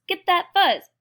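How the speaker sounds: loud, high call to grab; excited command. Acted. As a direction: urge them on, high and loud. Acted fight over fuzz.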